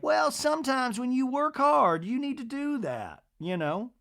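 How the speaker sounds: high-pitched society matron impression; Miss Patricia. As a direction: imitate a lady gossiping in a high, lilting voice. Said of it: "Miss Patricia's like".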